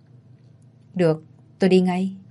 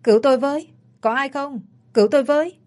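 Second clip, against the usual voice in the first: narrated scream for help; 6 semitones higher, faster than usual. A narrator's reading. Thảo, seized by the attacker, screams for someone to save her. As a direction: call out urgently for rescue, in a contained storyteller's scream. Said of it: "Thảo hét".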